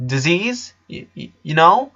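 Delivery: stunned brother stuttering; dumbfounded. A narrator's reading. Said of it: "he asked, dumbfounded".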